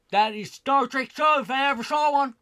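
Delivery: hammed-up pitchman selling a bad show; mock announcer. Mocking the Star Trek writers.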